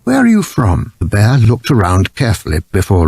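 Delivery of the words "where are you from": curious. A narrator's reading. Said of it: "said Missus Brown".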